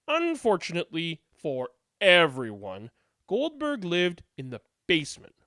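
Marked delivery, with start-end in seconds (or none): none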